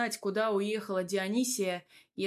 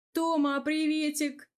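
high, drawn-out cheery hello; breezy hello with lifted pitch; cheerful greeting; 7 semitones higher, 3 dB louder than usual. A narrator's reading. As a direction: sing out a merry greeting, slowly. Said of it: "весело поздоровался он".

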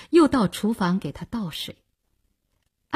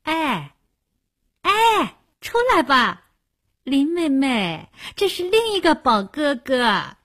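high, drawn-out teasing call; Ellie calling out; 5 semitones higher than usual. A narrator's reading. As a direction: coax her out in a high, slow singsong. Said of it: "艾丽的声音".

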